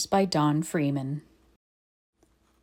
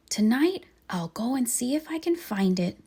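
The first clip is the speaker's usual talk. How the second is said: hopeful little bear, higher; small bear voice; 6 semitones higher than usual. Acted. Bear telling himself he'll find the button, high and hopeful.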